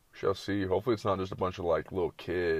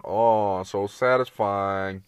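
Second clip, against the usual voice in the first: drawn-out, louder mock bliss; mock satisfaction; 5 dB louder, much slower than usual. Mocking Snapchat viewers of a trivial clip, drawn-out fake satisfaction. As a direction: stretch it out in fake delight.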